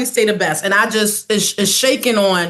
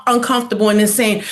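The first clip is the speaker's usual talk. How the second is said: ordinary talk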